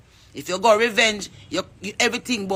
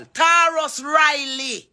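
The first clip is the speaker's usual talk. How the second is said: calling out; 6 semitones higher, slower than usual. Real, not acted. Calling out artist by name.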